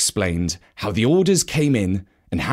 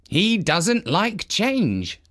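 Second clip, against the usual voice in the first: higher, very slow, cautious steward; Dusty, wary; 7 semitones higher, much slower than usual. A narrator's reading. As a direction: lean on change as the steward warns. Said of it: "said Dusty".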